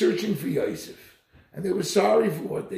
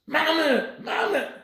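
high, loud cry to his mother; a son's loud calling cry; 14 semitones higher, 5 dB louder, slower than usual. Retold or imitated. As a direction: call out across the harbor. Son calling mother at harbor.

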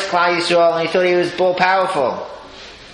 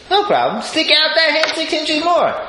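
breezy tailor; 12 semitones higher than usual. Retold or imitated. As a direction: reassure him like a sly tailor. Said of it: "He says".

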